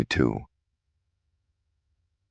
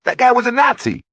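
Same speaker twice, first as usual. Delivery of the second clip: much higher, louder heckling voice; heckler; 7 dB louder than usual. A narrator's reading. A heckler in the crowd, loud scornful jeer. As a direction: jeer it across the room.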